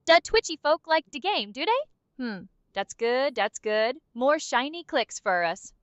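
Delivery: ordinary delivery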